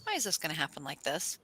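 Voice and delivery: soft, deflated asking; subdued question. Acted. Asking why it all goes wrong, quietly resigned. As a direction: let the question sink, quietly.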